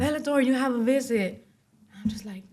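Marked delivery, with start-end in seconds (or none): 0.0-1.3 lightly voiced guard, pitched higher; retold call to an inmate, raised pitch. Guard calls inmate by surname.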